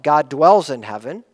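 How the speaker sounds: usual speaking voice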